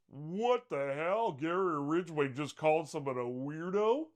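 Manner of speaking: high, slow, drawn-out disbelief; a stunned, slow what the hell; incredulous disbelief. Real, not acted. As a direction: stretch it out, astonished. Reacting to killer calling someone weirdo.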